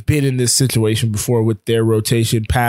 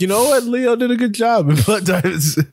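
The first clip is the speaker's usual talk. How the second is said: high, sung-out mock epiphany; singsong mock realization; 9 semitones higher than usual. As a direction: play an awed fan, singing it. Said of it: "you're like".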